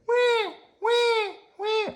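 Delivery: very high wailing wah; baby crying in the waiting room. Acted. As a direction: wail like an infant.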